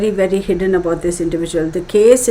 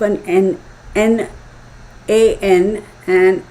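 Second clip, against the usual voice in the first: halting, stumbling over the word's start; stuttering; slower than usual. Real, not acted. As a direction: get stuck on "N", with pauses. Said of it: "now I feel like I'm stuttering".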